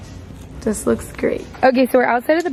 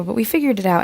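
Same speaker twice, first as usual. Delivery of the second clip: ordinary delivery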